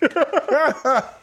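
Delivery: a short high burst of laughter; high-pitched laugh at himself. Real, not acted. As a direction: laugh at your own story.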